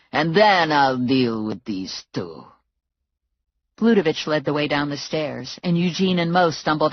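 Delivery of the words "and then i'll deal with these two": slow thug voice. A narrator's reading. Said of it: "he said".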